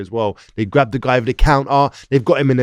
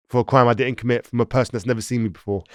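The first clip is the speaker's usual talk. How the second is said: usual speaking voice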